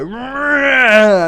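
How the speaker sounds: loud sudden roar; imitated roar. Boy flipping a table, roaring out loud. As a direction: bellow it while flipping a table. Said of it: "He would go".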